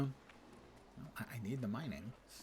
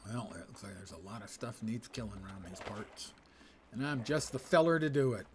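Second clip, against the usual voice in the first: mock cowboy accent; 4 dB louder than usual. Gamer slips into a playful cowboy accent about monsters to kill. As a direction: play a lone frontier gunslinger, lightly. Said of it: "I'm suddenly talking in that accent".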